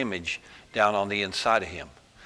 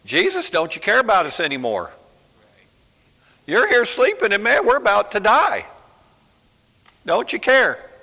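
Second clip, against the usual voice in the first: higher, louder desperate pleading in the storm; panicked disciples; 10 semitones higher, 6 dB louder than usual. Acted. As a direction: beg Jesus as if the boat is sinking. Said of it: "started panicking, started crying".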